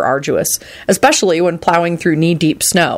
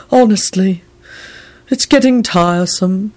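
mildly grumbling servant; 2 semitones higher than usual. A narrator's reading. Servant fed up with bodies in the way, grumbling. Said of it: "he grumbled".

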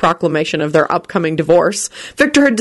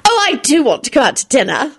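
higher-pitched eager squeal; mild squeal; 8 semitones higher than usual. A narrator's reading. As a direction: pipe up, delighted at the idea. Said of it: "squealed Rosie".

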